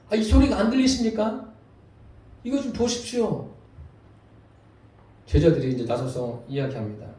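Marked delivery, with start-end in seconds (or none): 0.1-3.5 raised, higher-pitched appeal; acted-out fed-up plea to the master; exasperated complaint. Disciples complain to Jesus. (답답해하며 항의하듯 높인 목소리)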